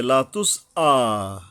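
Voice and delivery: "লা তুস আ" recited slowly with a hard glottal onset on "আ"; firm hamza. Shown as a demonstration. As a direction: break after "তুস", then hit "আ" firmly from the throat. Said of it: "হলকের গোড়ার থেকে শক্তভাবে আদায় হবে".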